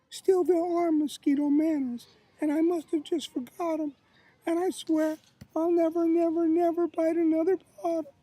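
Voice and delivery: high, loud little mosquito voice; apologetic mosquito. Acted. Mosquito apologizes to the bitten child, high pleading character voice. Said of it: "Then he said".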